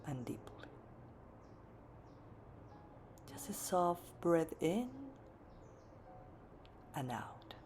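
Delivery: soft, low meditation voice with long pauses; hushed and unhurried, leaving space to breathe; guided meditation. Real, not acted. Yoga teacher guiding the class into seated meditation, soft guided-meditation voice.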